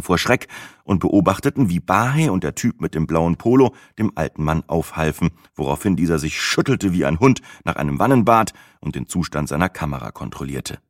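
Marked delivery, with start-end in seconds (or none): none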